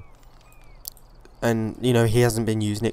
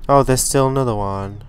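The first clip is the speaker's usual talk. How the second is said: normal talking voice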